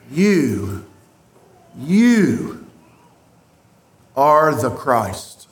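"You, you" set apart by pauses, "are the Christ" slow and low; Peter's solemn confession, stressing "You"; emphatic you. Retold or imitated. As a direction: let each "You" stand alone before the slow end. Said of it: "you is emphatic".

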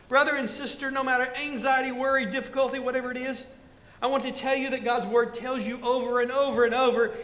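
rising preacher's exhortation; preaching. Acted. Pastor urging his flock, building preaching. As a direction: press the promise on the congregation.

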